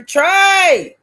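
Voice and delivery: loud, high, drawn-out call. Real, not acted. Host hollers for Trey to answer, calling out. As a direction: holler out the name.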